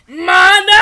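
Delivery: very loud shouted name; angry shout. Acted. Man calls Mana angrily from far, loud shout. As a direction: bellow the name from afar. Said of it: "A deep voice shouted".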